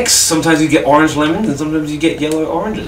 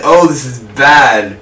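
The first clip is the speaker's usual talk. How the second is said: usual speaking voice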